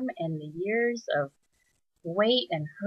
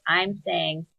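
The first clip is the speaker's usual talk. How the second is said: ordinary delivery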